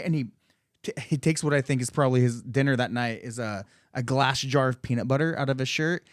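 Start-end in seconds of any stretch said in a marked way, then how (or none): none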